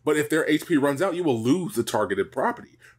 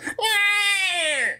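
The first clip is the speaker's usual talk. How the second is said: dinosaur voice. Pretend. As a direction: cry out like a dinosaur. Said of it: "the dinosaur voice would have been like".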